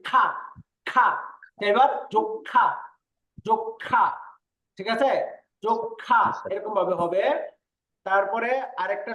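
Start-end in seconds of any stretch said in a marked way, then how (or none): 0.1-1.1 খা with a strong puff of air; aspirated kh. Shown as a demonstration. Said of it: "বাতাসের ঝাপটা বেশি বের করে বলতেছি". 1.7-6.2 যক্ষা with a held, breathy ক্ষ; almost doubled kh in যক্ষা; aspirated kkh. Shown as a demonstration. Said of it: "মনে হয় যেন ডাবল, কিন্তু ডাবল না".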